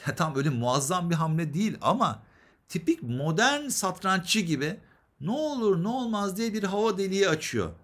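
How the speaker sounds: ordinary delivery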